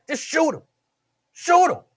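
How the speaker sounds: mock egging-on yell; urging shout. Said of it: "Wyatt like".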